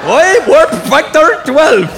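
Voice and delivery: high, loud, drawn-out mock Scottish accent; Scotty. Engineer balking at warp speed, exaggerated Scottish accent. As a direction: stretch it out in a mangled Scottish accent. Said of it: "Scotty in Star Trek was the only one we couldn't understand".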